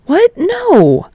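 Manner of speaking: high, flustered sputter; startled friend sputtering a refusal. Acted. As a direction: caught off guard, sputtering. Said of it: "Kirishima sputtered".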